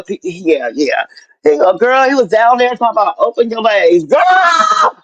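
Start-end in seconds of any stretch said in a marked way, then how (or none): 4.0-5.0 sky-high embarrassed squeal. Real, not acted. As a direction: squeal the word like you can't take it. Shrieking at friend over story.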